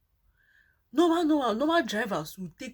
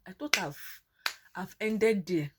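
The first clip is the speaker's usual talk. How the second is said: ordinary talk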